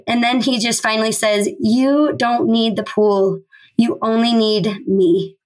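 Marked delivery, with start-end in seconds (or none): 1.6-5.4 slow and measured retold words of Christ; slow, deliberate. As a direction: give each word room, unhurried. Christ's final answer, retold.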